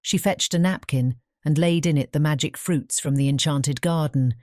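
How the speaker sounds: usual speaking voice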